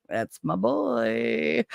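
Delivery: mock-doting drawl; retold parental pride. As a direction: stretch it out like a proud parent. Retold proud parent's reaction.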